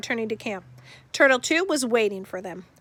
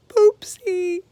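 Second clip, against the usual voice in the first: plain reading voice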